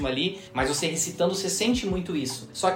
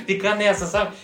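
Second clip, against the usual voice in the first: laughing; 6 semitones higher than usual. Real, not acted. Poet laughing at own hunt for syllables, laughing. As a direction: laugh while explaining the struggle.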